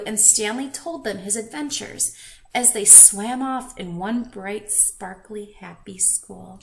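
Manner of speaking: plain reading voice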